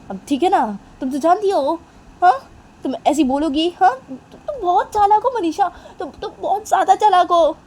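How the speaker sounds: high, loud mock complaint; acted indignant protest; exasperated accusation. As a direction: accuse them, voice climbing. Accusing partner in acted quarrel.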